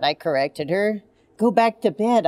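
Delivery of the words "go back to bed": usual speaking voice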